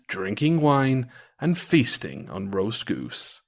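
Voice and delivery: ordinary delivery, nothing marked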